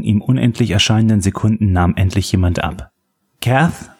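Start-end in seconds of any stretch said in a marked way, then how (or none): 3.4-3.9 higher, questioning call. A narrator's reading. Andy calls into the phone when someone answers, calling out. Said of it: "rief er".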